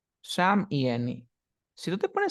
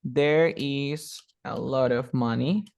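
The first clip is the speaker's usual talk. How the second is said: very slow, clear teacher demo; slow model sentence; much slower than usual. Shown as a demonstration. Teacher slowly models the English sentence for the student, slow and clear. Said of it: "¿Qué vas a decir?".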